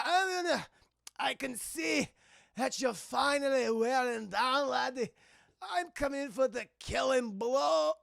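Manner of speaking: exhausted Scotsman. Retold or imitated. Scotsman after hours of fighting, mock exhausted. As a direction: push the threat out as a worn-out Scot. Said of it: "exhausted. They're going".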